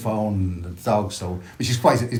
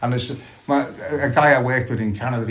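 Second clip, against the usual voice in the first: ordinary delivery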